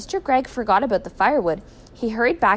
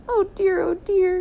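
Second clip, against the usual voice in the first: high-pitched, distressed wife; acted high weeping voice; tearful wail; 11 semitones higher than usual. A narrator's reading. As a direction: lament as if starting to cry. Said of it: "began to cry".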